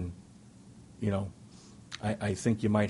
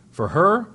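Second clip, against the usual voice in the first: higher and louder on "for her"; emphatic; 8 semitones higher, 8 dB louder than usual. Real, not acted. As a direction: punch "For her" up high and loud. Stressing wife's difficulty.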